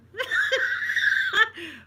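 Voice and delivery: loud, high cackling laugh; burst of laughter. Real, not acted. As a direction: crack up at your own story. Real laughter at the story.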